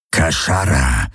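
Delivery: low growled alien word; growling. A narrator's reading. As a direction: growl it like a predator. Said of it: "knurrte er".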